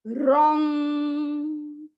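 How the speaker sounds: रॉन्ग drawn out long, chanted evenly; full length. Shown as a demonstration. Teacher chanting रॉन्ग at full length. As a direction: give रॉन्ग its full time. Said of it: "रो नहीं बोलना है".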